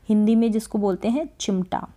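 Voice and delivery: ordinary talk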